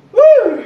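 loud high hoot; high whoop. Real, not acted. Coach whoops after set.

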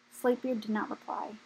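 ordinary delivery, nothing marked

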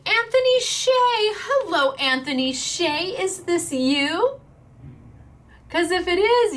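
high, loud winner call-out; excited announcing. Real, not acted. Host announcing the giveaway winner, high and loud.

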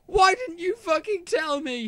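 very high-pitched outraged cry; angry shout. A narrator's reading. Draco confronting Ebony, shouting angrily. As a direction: demand it in a high, strained shout. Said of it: "he shouted".

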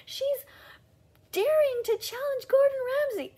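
very high, loud, squealing mock awe; shrill-free falsetto gush, hammed up; mocking gushing fans. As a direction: parody the hysterical duet reactors. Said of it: "Everybody's like".

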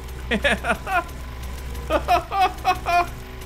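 long, high-pitched giggling; high laugh. Pretend. Laughs at staring character.

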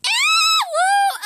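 high, loud excited whoop; a squealing woo of delight. Real, not acted. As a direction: cheer it out, thrilled. Excited cheer over drawing.

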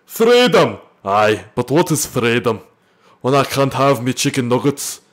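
loud, badly faked Scottish accent; mock Scottish battle cry, easing into Scottish-accented speech. Shown as a demonstration. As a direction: ham it up as a shouting Scottish warrior. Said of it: "can you please do a Scottish accent".

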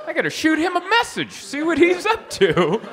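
shocked disbelief. Retold or imitated. Comic seeing his uncle on TV, high squealing shock. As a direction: shriek it like you can't believe your eyes. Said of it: "And I was like".

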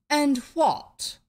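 sudden question. A narrator's reading. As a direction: cut in abruptly. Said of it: "said the king suddenly".

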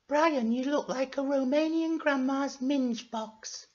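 slow, high falsetto insult; sweetly rude falsetto character; Florence. Acted. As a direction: put on a high girly voice. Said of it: "said Florence".

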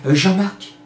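une petite voix aiguë, légèrement voilée; la voix intérieure qui l'appelle. Pretend. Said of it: "une petite voix qui m'a dit". (petite voix intérieure qui souffle)

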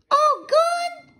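put-on very high, loud toddler squeal; Fudge asking anxiously. A narrator's reading. Little brother asking about his teeth, high and babyish. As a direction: babyish small-child question.

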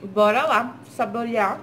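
chewing while talking; muffled by food; mouth full. Real, not acted. Seen 2: Bites burger at -3, chews visibly, mouth clear by 5.4.

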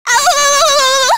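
pained yelp. Acted. Character gets hit.

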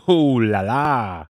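hammed-up French "oh la la", slow and drawn out; lightly mocking French flourish. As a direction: camp it up like a cartoon Frenchman. Mock French exclamation after laugh.

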